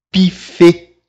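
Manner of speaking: "Pifê" with a pouted French u in the first syllable; "Pifê" as French buffet, u fronted. Shown as a demonstration. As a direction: round the lips on the first vowel of "Pifê". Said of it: "tem o som do U também".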